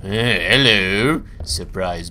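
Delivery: normal talking voice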